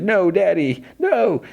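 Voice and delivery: put-on high, childlike cry of "No, Daddy"; pleading child. Little boy begging his father not to shut the door, in a high pleading voice.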